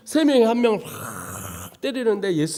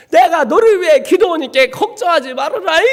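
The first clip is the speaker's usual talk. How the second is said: mother's voice; 9 semitones higher, 8 dB louder than usual. Retold or imitated. Mother's tearful words retold.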